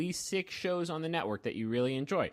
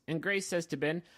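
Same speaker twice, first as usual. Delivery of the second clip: ordinary delivery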